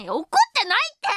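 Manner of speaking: high, loud, rushed outburst; hammed-up clingy protest that they're not mad; mock angry denial. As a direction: snap it back in put-on exasperation. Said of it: "メンヘラムーブがうますぎて".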